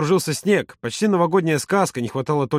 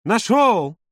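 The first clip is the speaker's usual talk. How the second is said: high victorious cry; triumphant; 7 semitones higher, 3 dB louder than usual. A narrator's reading. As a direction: announce the find in triumph. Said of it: "раздалось победное". (победный возглас)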